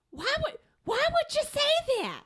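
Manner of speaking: high-pitched New York therapist; hammed-up imitation of a New York accent, way up high. Therapist questioning her client's self-talk, high New York accent. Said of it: "she has a New York accent".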